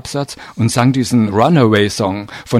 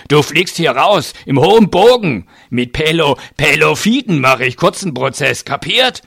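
angry bully with raised pitch; growled threat; 5 semitones higher than usual. A narrator's reading. Giant threatening to throw the man off the train, growling. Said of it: "knurrte der Fleischberg".

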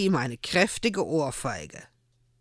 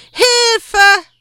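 high, loud desperate cry for help; shouting for help; 12 dB louder than usual. A narrator's reading. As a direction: scream it from far off. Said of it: "Der Schrei kam von rechts und noch einmal".

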